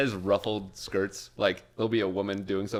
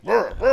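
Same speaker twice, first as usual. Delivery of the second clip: braid-tugging noise; 12 semitones higher, 10 dB louder than usual. Retold or imitated. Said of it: "she's just like".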